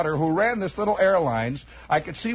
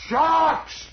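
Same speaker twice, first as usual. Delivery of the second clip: hollered order; 10 semitones higher than usual. Retold or imitated. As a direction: snap it out like a movie pilot. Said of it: "hollered out".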